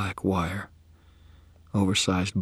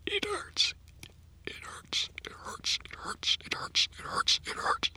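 whispered over and over until the breath runs out; a man in agony murmuring the same words; softer whisper, fading out of breath; repeated pained whisper; 8 dB quieter than usual. Acted. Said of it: "he went on and on, repeating it until he ran out of breath".

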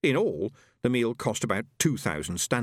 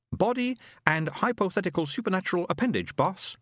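higher, precise machine voice; pompous robot; 10 semitones higher than usual. A narrator's reading. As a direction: list it like a know-it-all robot. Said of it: "said the robot hurriedly".

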